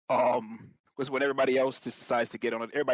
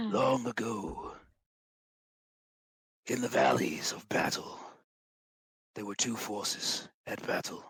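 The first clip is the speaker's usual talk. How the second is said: epic fantasy narrator. Shown as a demonstration. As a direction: tell it like an ancient war saga. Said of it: "I need to be more epic".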